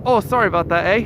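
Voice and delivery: Canadian impression without the "mate"; Canadian accent. Redoing the caller's apology without mate, mock Canadian accent. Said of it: "best Canadian accent".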